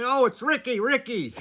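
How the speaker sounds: high, loud, laughing call of the name; excited kids. Retold or imitated. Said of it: "They go".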